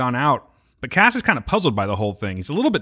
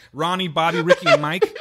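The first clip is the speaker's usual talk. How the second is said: reciting the names through laughter; giggling the names out; laughing; 5 semitones higher than usual. Real, not acted. Quoting the New Edition song.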